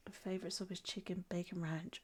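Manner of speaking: soft whispered read. Pretend. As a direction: whisper the message aloud. Reading out a chat message.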